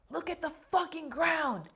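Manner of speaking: high-pitched retold drill yell. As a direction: imitate an instructor yelling at a line of recruits. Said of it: "he comes start yelling at us".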